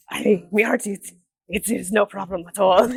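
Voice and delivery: playful Irish-Scottish pirate accent; jokey pirate with an Irish-Scottish lilt; Porter Starboard. Shown as a demonstration. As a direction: answer thanks as a comic Scottish-Irish pirate. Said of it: "that is his voice".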